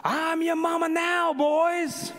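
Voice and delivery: high, drawn-out bellow, imitating a drill sergeant; drill-sergeant yell. Man in uniform greets recruits off the bus, yelling. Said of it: "this man comes out in a uniform and yells".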